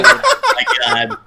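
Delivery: very high, loud laughing squeal; Valley Girl squeal. Pretend. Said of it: "Arnold Schwarzenegger talking like a Valley Girl".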